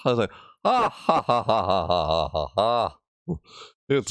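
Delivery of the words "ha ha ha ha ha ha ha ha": reading out a laugh. Pretend. Streamer acting out the written laugh, mock villain laugh. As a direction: read the ha-has as a hammy villain laugh. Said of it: "I like it when I go".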